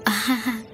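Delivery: high-pitched mad laugh; short deranged cackle, performed; acted crazed laugh. A narrator's reading. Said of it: "Út Duyên cười điên dại".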